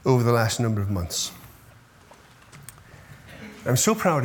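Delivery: real pride catching in the throat; choked up. Real, not acted. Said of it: "oh, I'm getting worked up".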